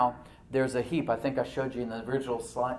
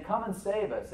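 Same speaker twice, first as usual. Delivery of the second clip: crying out; 7 semitones higher than usual. Retold or imitated. French people under occupation begging rescue, imitated crying out. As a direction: imitate a call for rescue, raised pitch. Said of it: "like the French people saying".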